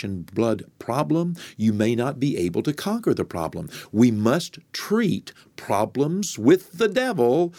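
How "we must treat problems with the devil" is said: slow, higher, each word weighted; emphatic slow preaching. Acted. Preacher stressing how to treat the devil, emphatic. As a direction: drive the line home slowly. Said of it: "like problems with the devil".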